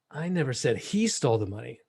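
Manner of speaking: "he" stressed and set apart. Shown as a demonstration. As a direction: point the blame away with a leaned-on "he". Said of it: "listen to how I say this".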